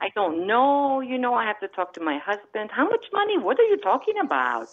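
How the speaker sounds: high, indignant Croatian-Italian accent; mother's foreign accent. Shown as a demonstration. Stalling a scam caller about money, foreign accent. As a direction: protest in a heavy foreign accent, pitch climbing. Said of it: "I can talk like this".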